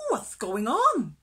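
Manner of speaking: loud, swooping question; eager curiosity. A narrator's reading. Little fish joining his friends, loud eager question. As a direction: ask it loudly with a big lilt.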